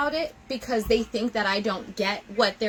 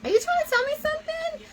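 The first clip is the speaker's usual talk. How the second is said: playful question; faster than usual. Retold or imitated. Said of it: "And I'm like".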